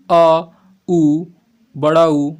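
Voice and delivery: अ and उ sounded out loud and clear, then बड़ा उ drawn longer; vowel demo. Shown as a demonstration. Teacher sounding out back vowels for students, loud and clear. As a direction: pronounce each vowel boldly for the class. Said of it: "ये सभी किस में आएंगे".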